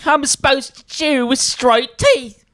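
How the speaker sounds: high, indignant British voice; mock British accent. Brit objecting to straight teeth, high mock British accent. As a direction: protest like a cartoon Brit. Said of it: "I like the British act".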